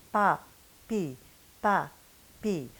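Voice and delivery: Pa, pi with light p and clear vowels; French pa pi. Shown as a demonstration. Teacher drilling French syllables, vowel first. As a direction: anticipate the vowel in pa and pi. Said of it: "Same thing with the E".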